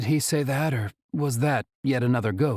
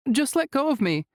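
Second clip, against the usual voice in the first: tense plea. A narrator's reading. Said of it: "she says".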